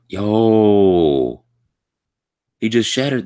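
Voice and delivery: loud startled yo; shocked outburst. Real, not acted. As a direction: blurt it out in shock. Shock at shattering.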